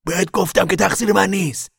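high, loud indignant protest. Acted. Al protesting the blame to Tom, indignant. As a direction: insist it is not your fault.

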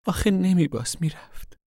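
very high-pitched upset protest; plaintive protest. A narrator's reading. Daughter protests to mother that he left, plaintive.

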